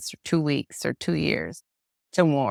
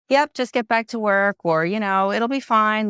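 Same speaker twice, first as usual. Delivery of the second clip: higher, louder breezy mock dismissal of pain; brushing off grief; 6 semitones higher, 4 dB louder than usual. Mocking people who tell the grieving to move on, breezy and dismissive. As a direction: toss it off like grief is nothing to bother with.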